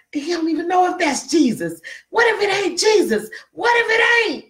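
high-pitched, escalating panic; mock alarmed doubting. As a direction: cry out like a worried onlooker, rising each time. Voices panicky doubters in the boat.

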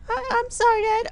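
very high falsetto, stammering apology; squeaky kid voice. Acted. Timmy apologising to his dad, squeaky kid voice.

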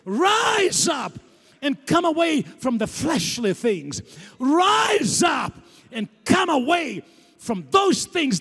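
rhythmic, repeated call, rising on each rise up; preaching call. Acted. Preacher voicing Jesus calling the church, fervent pleading. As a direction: preach it, pleading then lifting.

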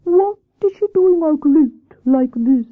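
high, slow hooting owl; Wise Owl voice. Acted. Owl questions Hare about the rabbit, slow hooting voice. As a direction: draw the vowels out like hoots.

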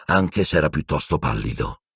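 plain reading voice

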